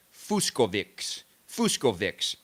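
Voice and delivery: "Fuskovics" said with a hard K in the middle; announcers' wrong Fucsovics. Shown as a demonstration. Mocking the announcers' wrong Fuskovics with a hard K. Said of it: "which is totally wrong".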